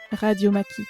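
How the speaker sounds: ordinary delivery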